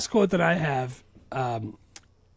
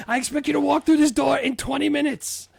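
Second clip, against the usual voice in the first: loud, much higher impression of a dad; hammed-up parental order; acted irate dad; 11 semitones higher, 5 dB louder than usual. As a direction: lay down the law as a movie dad. Acted dad giving Cher an order.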